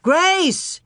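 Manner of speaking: high calls, climbing; father calling for lost daughter; shouting. A narrator's reading. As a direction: shout her name twice. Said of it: "he shouted".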